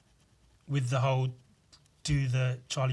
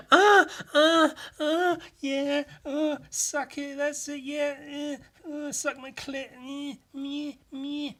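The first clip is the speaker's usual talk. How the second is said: much higher moaning mock porn voice; 13 semitones higher, 3 dB louder, slower than usual. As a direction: moan it out like a porn clip. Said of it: "porn video I was watching".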